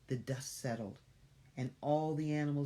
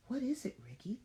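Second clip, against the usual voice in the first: high worried question; 10 semitones higher than usual. Acted. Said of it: "Rachel asked again".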